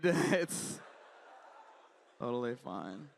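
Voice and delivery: normal talking voice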